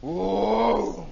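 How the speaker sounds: long hollow "whoo"; engine whoosh. Retold or imitated. Imitating the plane's approach, drawn-out whoosh.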